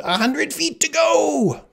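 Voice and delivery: very high, drawn-out yell; screaming. A narrator's reading. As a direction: scream it out, stretching the words. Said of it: "the Sicilian screamed".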